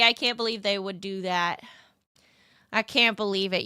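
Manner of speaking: ordinary talk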